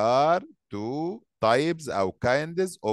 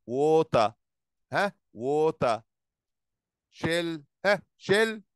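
ordinary talk, nothing marked

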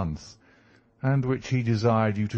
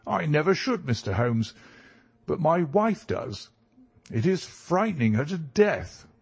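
higher-pitched client voice, concerned; concerned country gentleman; 5 semitones higher than usual. Acted. Client tells Holmes his wife is frightened, concerned and higher-pitched. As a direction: voice the visitor high, troubled for his wife.

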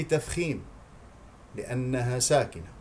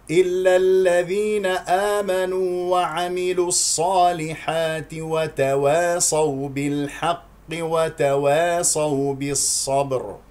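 slow, louder, sustained tajweed chant; Quran recitation; 4 semitones higher, 5 dB louder, much slower than usual. Shown as a demonstration. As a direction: recite the verse with long vowels. (تلاوة مرتلة بصوت أعلى)

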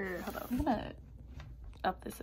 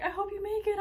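a passerby's upbeat call, higher; higher cheering voice; 11 semitones higher, 4 dB louder than usual. Retold or imitated.

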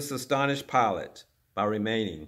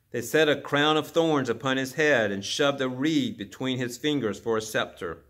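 ordinary delivery

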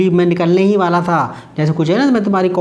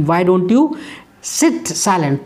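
very slow model phrase; much slower than usual. Shown as a demonstration. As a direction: stretch out sit silent for learners. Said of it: "teacher models English slowly".

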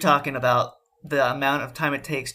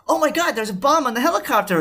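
alarmed; 10 semitones higher, 3 dB louder than usual. Retold or imitated. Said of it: "somebody goes".

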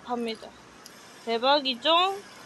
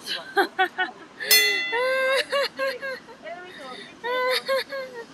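high laughing squeal; 10 semitones higher than usual. Real, not acted. Laughing at beauty filter.

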